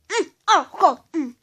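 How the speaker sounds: eating sour candy. Real, not acted. Reacting to very sour sweet.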